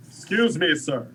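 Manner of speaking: loud, sharp call across the room. Acted. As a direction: call out too loud for a library. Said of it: "It's not a library voice".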